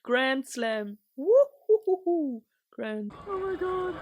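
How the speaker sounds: loud, slow woo-hoo cheer; whooping celebration. Real, not acted. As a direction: cheer like a fan in the stands. Cheering Oscar's win.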